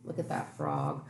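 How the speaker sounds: plain reading voice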